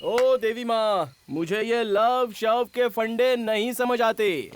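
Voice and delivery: slow, drawn-out exasperation; exasperated. Acted. Man fed up with her love talk, slow exasperated complaint. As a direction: stretch each word out in weary protest.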